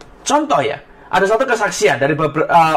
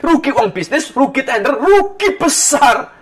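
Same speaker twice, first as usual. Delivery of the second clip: higher pitch, climbing to a drawn-out "besar"; building emphasis; 4 semitones higher, slower than usual. Retold or imitated. Passenger tallies his losses at airport, building emphasis. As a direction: pile up each "rugi", stretching the last.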